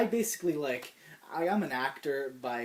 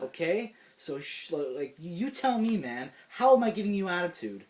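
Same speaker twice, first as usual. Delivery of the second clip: heated, higher and louder comeback; retold pissed-off challenge; 5 semitones higher, 6 dB louder than usual. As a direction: act out throwing the accusation back at him. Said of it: "this is when I start to get pissed".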